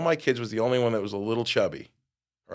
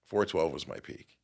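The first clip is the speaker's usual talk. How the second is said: ordinary talk, nothing marked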